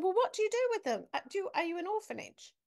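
high, puzzled questioning, speeding up; baffled question. Retold or imitated. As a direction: ask it as if the answer makes no sense. Asking nuns what they do.

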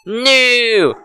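high, sudden cry of dismay. Acted. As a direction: cry out in horror. Banished to start, cries out.

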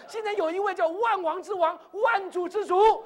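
high, loud, ringing proclamation; full-force preaching. Real, not acted. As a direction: proclaim the King's promise to the congregation. (高亢有力地宣讲)

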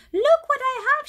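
shouting. Acted. Beverly running in to show Molly the shoes, excited shout. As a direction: burst in showing off the shoes. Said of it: "she shouted".

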